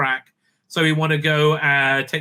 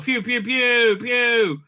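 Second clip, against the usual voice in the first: high imitated laser fire; blaster pew pews; 7 semitones higher, slower than usual.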